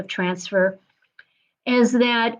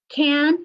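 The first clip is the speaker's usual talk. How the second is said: "Can" alone, held and pitched higher; single out "Can" on a raised, sustained note; stress on Can; 5 semitones higher than usual. Real, not acted. Coach singling out the key word for her group, stressing "Can". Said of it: "in parentheses".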